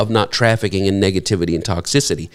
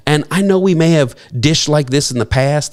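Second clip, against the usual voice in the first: ordinary talk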